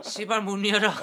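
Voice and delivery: shouted high through laughter; loud laughing punchline. Acted. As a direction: bark it out while cracking up.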